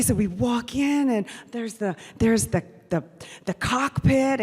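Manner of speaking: normal talking voice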